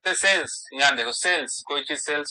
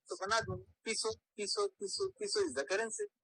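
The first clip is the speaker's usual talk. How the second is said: high pitched soft squeaky punchline; 11 semitones higher, 9 dB quieter than usual. Shown as a demonstration. Teacher lands the peso joke on students.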